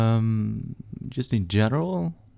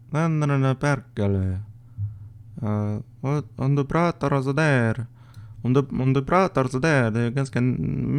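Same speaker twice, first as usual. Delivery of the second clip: Finnish accent; 3 semitones higher than usual. Shown as a demonstration. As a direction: try a nasal Finnish accent in Swedish. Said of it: "I've ever done a Finnish accent".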